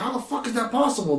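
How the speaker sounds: high incredulous squeak; high, stunned mock outrage; shocked disbelief. Gabriel shocked at Michael's return.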